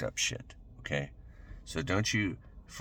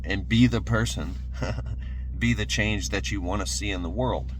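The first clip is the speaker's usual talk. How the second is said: pumped-up sermon, louder and higher; rising motivational preaching; 3 semitones higher, 3 dB louder than usual. Acted. Motivational speaker urging viewers to act, fervent preaching. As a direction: fire them up like a preacher on a roll. Rallying viewers to change their lives.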